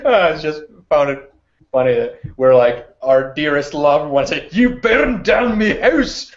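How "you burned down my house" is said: high, hammed-up Scottish accent; mock the Scottish accent, much higher. Player mocking Carl's Scottish line in a high Scottish accent. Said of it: "Sorry for making fun of your accent".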